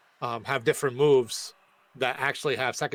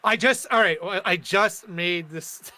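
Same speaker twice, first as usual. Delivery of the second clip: usual speaking voice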